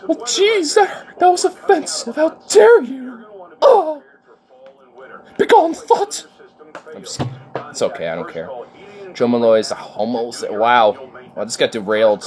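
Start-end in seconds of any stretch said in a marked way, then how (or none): 0.1-6.3 much higher, hammed-up offended voice; mock offended. Mock offense at a crude insult, high and hammed-up. 6.9-8.5 whispered aside. Real, not acted. Dismissing it quietly.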